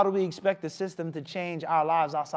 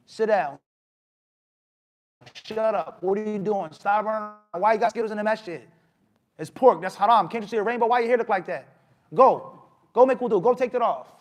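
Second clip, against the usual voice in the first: loud, higher, rapped-out orders; stern scolding; 4 semitones higher, 6 dB louder than usual. Acted. Scolder to a child in the masjid, sternly ordering. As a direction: tell a child off with loud, raised commands.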